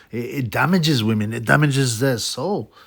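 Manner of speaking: ordinary talk, nothing marked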